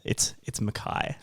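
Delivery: Mackay said "muh-KYE"; corrected surname pronunciation. Shown as a demonstration. Guest corrects the hosts on his surname, Mackay as "muh-KYE". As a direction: say Mackay slowly, ending to rhyme with sky. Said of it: "No, no, no, hold on".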